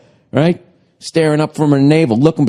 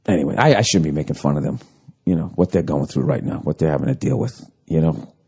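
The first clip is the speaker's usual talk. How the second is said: quiet sheepish aside; 11 semitones lower, 6 dB quieter, faster than usual. Real, not acted.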